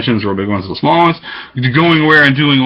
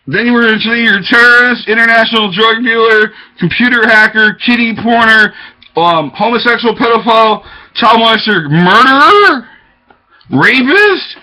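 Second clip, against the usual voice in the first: higher, louder list building to a shout; building accusation list; 7 semitones higher, 3 dB louder than usual. Retold or imitated. Voicing accusers reeling off charges at him, building to a shout. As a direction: rattle off the charges, rising.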